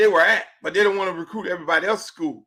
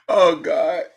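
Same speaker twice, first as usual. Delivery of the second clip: usual speaking voice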